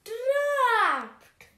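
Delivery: trapped lion panic. Acted. Lion caught in the trap cries out in panic. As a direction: shout it as the net snaps shut.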